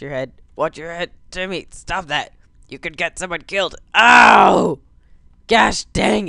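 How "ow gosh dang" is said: loud yelp of pain; pained yell. Real, not acted. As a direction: shout it like you got hit. Hit by a plane.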